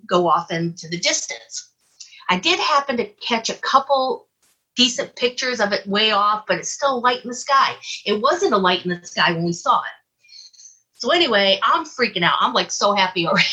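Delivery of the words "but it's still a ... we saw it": usual speaking voice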